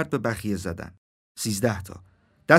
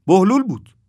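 loud, high-pitched announcement; calling out; 8 semitones higher, 7 dB louder than usual. A narrator's reading. Crow on the narrator's shoulder announcing who passed, calling out. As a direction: blurt the name out loudly.

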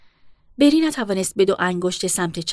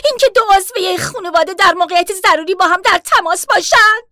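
higher, louder furious protest; raised voice; 10 semitones higher, 9 dB louder than usual. Acted. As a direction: shout her down across the counter. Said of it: "صدایش را بالا برد".

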